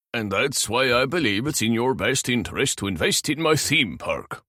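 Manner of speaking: put-on a thick Norwegian accent. A narrator's reading. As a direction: speak with a heavy Norwegian lilt. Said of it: "in his thick Norwegian accent".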